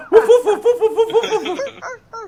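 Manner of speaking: very high, loud yappy woofs with a laugh; puppy barking. Pretend. Gamer imagining a puppy npc for friends, high yappy barks. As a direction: bark like an excited little puppy. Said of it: "a little puppy that ran up".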